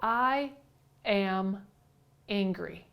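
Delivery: "I", "am", "angry" spaced by clear pauses; each word set apart. Shown as a demonstration. Teacher replaying the listener's words, slowly separated. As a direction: spell out "I am angry" word by word, dropping away. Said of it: "What you really just said there was".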